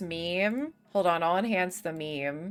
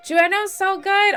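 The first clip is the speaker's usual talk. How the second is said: sudden gasp, much higher and louder; excited gasp; 12 semitones higher, 7 dB louder than usual. Real, not acted. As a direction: gasp with delight at the name. Gasps at game name.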